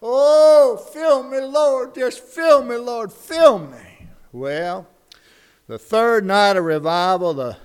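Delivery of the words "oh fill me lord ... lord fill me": high, loud, slow tearful begging; crying plea. Acted. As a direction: beg the Lord through mock tears. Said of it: "he's crying".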